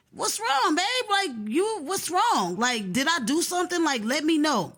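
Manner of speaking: high-pitched retold pleading; concerned calling out. Woman asking her upset friend what is wrong, high pleading call.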